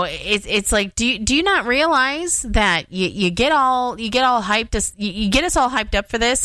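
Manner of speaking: normal talking voice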